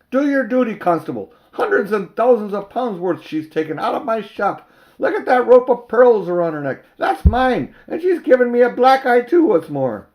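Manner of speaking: high, loud outraged shopkeeper; a furious fat man howling for justice; indignant crying out. Acted. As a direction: shriek your grievance at the constable. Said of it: "cried the fat man".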